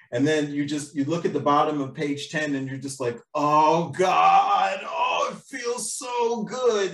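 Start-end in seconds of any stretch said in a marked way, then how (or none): 3.3-6.9 drawn-out, higher-pitched ecstatic groan; mock-ecstatic, stretched-out exclamation; blissed-out relief. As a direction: savour it slowly, overcome with euphoria. Acts out finishing ten pages.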